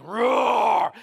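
loud imitated lion roar; lion's roar. John expecting the Lion of Judah, mock roar. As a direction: let out a big drawn-out roar. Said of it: "looking up expecting".

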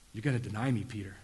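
quiet rebuke. Retold or imitated. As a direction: let it drop to a hush. Jesus confronting Peter, quiet.

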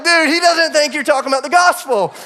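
high, loud, laughing through the words; can barely get it out for laughing. Real, not acted. Laughing while quoting himself.

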